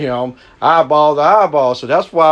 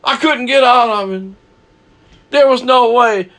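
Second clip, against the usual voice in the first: high pleading whine, excuses tumbling out; mock a guilty man pleading he couldn't help it; hammed-up high whine of a man making excuses to the preacher; whiny excuse; 8 semitones higher than usual. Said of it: "don't come up to me and say".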